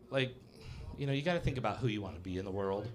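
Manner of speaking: usual speaking voice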